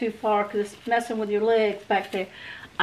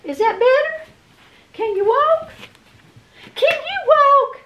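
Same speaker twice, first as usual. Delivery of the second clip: very high, slow cooing. Real, not acted. Owner asking the dog if he can walk, very high cooing. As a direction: coax the dog gently, pitched way up.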